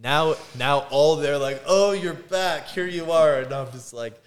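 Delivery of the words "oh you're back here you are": drawn-out, higher, louder mocking greeting; mock welcome. Instructors greeting a returning trainee, mockingly. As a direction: imitate instructors taunting with a slow welcome.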